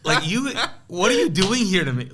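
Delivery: high, loud, laughing protest; laughing incredulity. Retold or imitated. Complaining to his friend about the tiny Bible, laughing. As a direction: laugh it out in disbelief.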